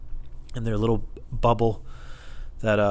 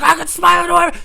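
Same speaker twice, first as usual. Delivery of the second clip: high, loud retort; screaming defiance; 11 dB louder than usual. Retold or imitated. Employee answering the customer back, screaming defiance. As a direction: yell back in re-enacted rage. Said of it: "screaming at each other".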